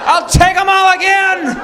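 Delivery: very high, loud call; hammed-up prankster shout; gleeful yell. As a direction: holler it across the yard. Gleefully calls to shelter staff.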